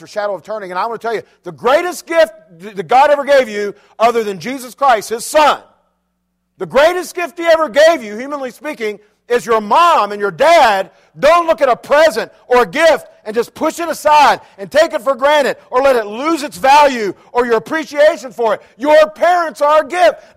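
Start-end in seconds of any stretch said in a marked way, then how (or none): 1.4-18.6 loud, raised preaching cry; preaching at full force. Acted. Preacher exhorting teenagers about their parents, full force. 18.8-20.4 slow, loud, high shout of the refrain. Shown as a demonstration. Preacher shouting the refrain to teens, slow and loud. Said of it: "Let me say it again".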